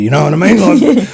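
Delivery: rising thrill in the telling; excited. Real, not acted. Excited retelling climax.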